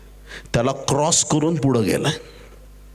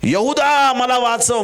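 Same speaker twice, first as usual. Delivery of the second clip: high, drawn-out call for rescue; cry for help; 10 semitones higher, 3 dB louder, slower than usual. Acted. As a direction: call out to a companion as you sink. Drowning Peter cries for rescue.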